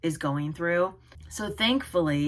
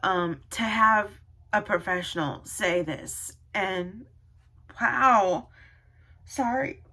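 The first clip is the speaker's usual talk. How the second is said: tearful, halting, rising in pitch; real crying; 3 semitones higher than usual. Real, not acted. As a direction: break off and apologise through tears. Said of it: "I don't know why I'm, like, crying so much".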